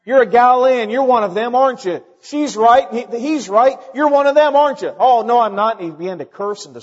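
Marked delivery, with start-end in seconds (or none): 0.0-4.9 loud, high-pitched accusers piling on; acted accusing crowd. Judeans confronting Peter by the fire, loud acted accusation. 5.0-5.8 loud, raised-pitch denial; acted protesting denial. Peter denying to his accusers that he knows Jesus, loud protest.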